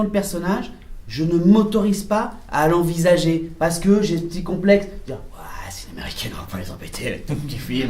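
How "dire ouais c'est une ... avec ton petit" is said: whispered self-doubt, much softer; hushed inner thought. Retold or imitated. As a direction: murmur your complex to yourself.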